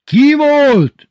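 very high, loud shriek of a question; sudden yell. A narrator's reading. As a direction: explode into the question. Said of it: "üvöltött hirtelen". (hirtelen, éles üvöltés)

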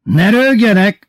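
sudden high, loud shout; headmaster yelling. A narrator's reading. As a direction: yell at the laughing class. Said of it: "üvöltött hirtelen az igazgató". (hirtelen dühös üvöltés)

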